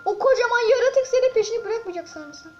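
loud, high-strung panic, calming himself; nearly yelling, trying to say calm down; panicked. Real, not acted. Gamer chased by giant creature, loud panicked self-talk.

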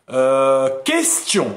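ordinary delivery

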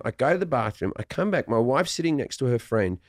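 normal talking voice

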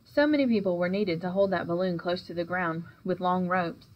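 ordinary delivery

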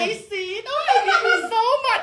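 high, slow, sugary imitation of a gushing friend. Friend imitated gushing thanks in English, high, sugary and slow. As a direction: stretch it out sweetly like an affected girl. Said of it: "อีหมวยก็ประดักประเดือเวลาพูด".

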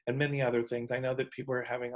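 usual speaking voice

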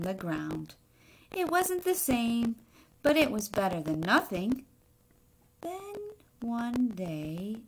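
put-on expressive read-aloud to children; story reading. A narrator's reading. Teacher reading a picture book to the class, expressive narration. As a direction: tell the egg's story to the class, lightly dramatic.

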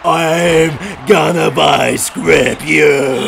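voicing the wrestler. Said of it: "He's like".

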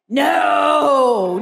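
long, drawn-out protesting no; mock exasperated. Clerk objecting to a smile in a passport photo, mock exasperated. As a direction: stretch it out like an appalled clerk.

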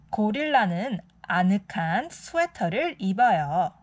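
ordinary delivery

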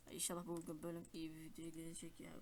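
soft hopeful whisper. Real, not acted. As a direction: breathe the wish, unvoiced. Hoping under breath. (fısıltıyla)

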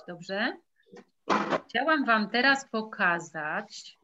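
normal talking voice

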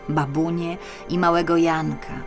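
usual speaking voice

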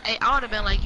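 ordinary delivery

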